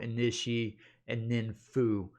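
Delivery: normal talking voice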